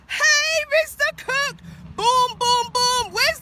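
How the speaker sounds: shouting high and loud across the park; calling out. Real, not acted. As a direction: hail him from a distance, fired up. Calling out to Mister Cook.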